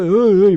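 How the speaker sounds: loud mock wail; imitated crying. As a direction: burst into loud, pretend sobbing moans. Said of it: "llorando y andando". (gemidos de llanto imitados, fuertes)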